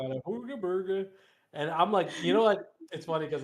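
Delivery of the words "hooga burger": lightly voiced mock Swedish accent; a quick goofy Swedish chef-style accent; a hint of mock Swedish accent, playful; Swedish meatball guy impression. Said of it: "in the Swedish Meatball guy".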